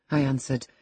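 ordinary delivery

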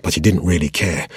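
plain reading voice

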